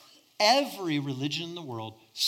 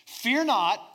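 proclaiming fear not; 7 semitones higher, 4 dB louder than usual. Acted. Preacher proclaims angel's words.

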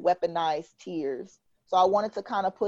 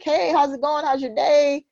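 higher-pitched, mock-friendly greeting; chirpy small-talk opener; 7 semitones higher, 4 dB louder than usual. Texter opening small talk with someone, chirpy greeting. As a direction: imitate an eager conversation starter.

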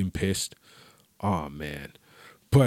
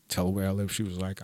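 normal talking voice

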